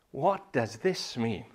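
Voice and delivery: What does this mean, said slow and full; slow emphatic question. Shown as a demonstration. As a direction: lean on the slow repeat.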